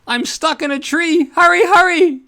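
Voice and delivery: put-on very high, loud child's cry; a small boy calling for help from a tree; little boy crying out. A narrator's reading. Boy stuck in a tree calls to Stan, high and loud. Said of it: "when a little boy cried".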